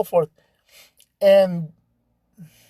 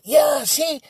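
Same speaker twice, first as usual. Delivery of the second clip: much higher, triumphant; mock triumphant yelp; excited vindication; 10 semitones higher than usual. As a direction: prove your point gleefully. Ladies vindicated.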